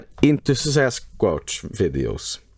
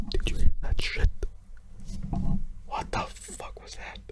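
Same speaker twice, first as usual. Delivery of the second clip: hushed, alarmed whisper; fearful whisper, slowing at the end; scared whisper; 9 dB quieter than usual. Real, not acted. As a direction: whisper as if something is outside. Startled by sound in video.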